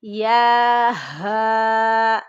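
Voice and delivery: the letter ہا sung out slowly with a heavy throat; heavy throaty ha. Shown as a demonstration. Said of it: "بھاری آواز میں ادا کریں گے".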